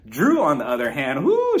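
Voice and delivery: ordinary delivery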